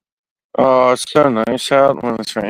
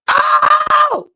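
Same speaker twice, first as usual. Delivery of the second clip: screamed yelp. Shown as a demonstration. Retrying the shout for the camera, screaming. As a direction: scream it out sharp. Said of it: "trying to make it sound more like this".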